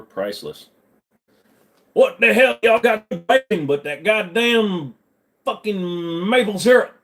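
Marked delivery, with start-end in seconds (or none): 1.9-7.0 loud, high Texan drawl, syrup said slowly; Texan accent. Pretend. Streamer plays a Texan tourist mocking Canadians, high Texan accent. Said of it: "As a native Texan".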